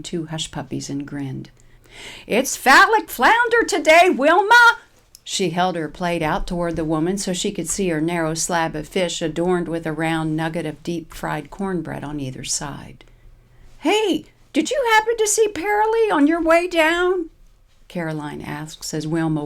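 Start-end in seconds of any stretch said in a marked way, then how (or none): 2.3-4.8 put-on cheerful old lady calling out. Caroline calls out across room. 13.8-17.3 put-on chirpy old lady voice. Caroline calls to Wilma.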